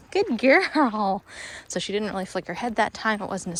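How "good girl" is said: higher, louder dog praise; happy praise. Real, not acted. Trainer rewarding her dog's turn, high and loud.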